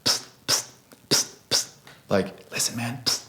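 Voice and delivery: hushed psst to catch someone's attention; sharp, secretive "psst", words kept very soft; attention-getting whisper. Shown as a demonstration. Beatboxer showing how you'd get someone's attention, whispering. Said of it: "like, whispering to them".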